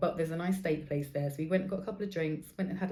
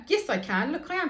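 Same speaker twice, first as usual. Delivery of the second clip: defiant; 9 semitones higher, 4 dB louder than usual. Retold or imitated. Arguing back with therapist.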